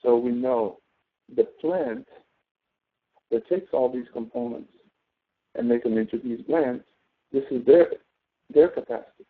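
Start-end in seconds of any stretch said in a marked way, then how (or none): none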